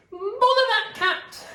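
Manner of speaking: high, slow exasperated mother voice; cross Mrs Thomas. Acted. Missus Thomas scolds the cat, high and exasperated. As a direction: huff it out like a fed-up mum.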